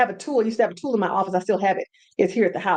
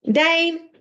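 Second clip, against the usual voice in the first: sharp call to get attention; 6 semitones higher than usual. Real, not acted. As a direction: call the name up high. Calling out to pet.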